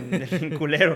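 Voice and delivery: loud line broken by laughter; laughing. Real, not acted. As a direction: crack up while saying it. Joke lands, both laugh.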